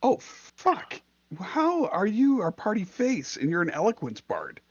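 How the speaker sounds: high-pitched stunned outburst; can't believe it, voice jumps up; shocked disbelief. Real, not acted. Shocked at eloquence bard.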